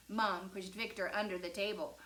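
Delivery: ordinary delivery, nothing marked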